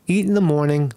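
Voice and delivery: ordinary delivery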